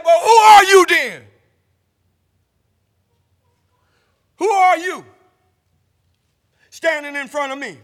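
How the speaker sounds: mock shout at the father; hammed-up loud, high demand; son's loud retort. Retold son shouting at his drunk father.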